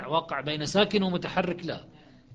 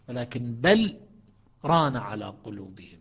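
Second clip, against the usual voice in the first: lower, بل then a stop, ران clear and unmerged; pause after بل; 3 semitones lower than usual. Shown as a demonstration. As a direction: recite بل, pause, then ران على قلوبهم. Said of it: "شوف شلون تجيك صافية". (سكتة على بل وراء غير مدغمة)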